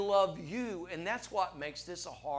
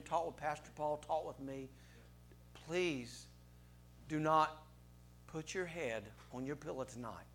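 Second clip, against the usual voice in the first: quiet earnest appeal; 5 semitones lower, 9 dB quieter than usual. Real, not acted. Earnest closing appeal to congregation.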